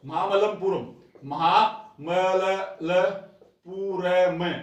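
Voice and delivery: महामल्लपुरम drawn out very slowly, each syllable clear; slow spelled place name. Shown as a demonstration. Teacher pronounces the meeting place slowly for students. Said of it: "Mahabalipuram भी बोलते हो".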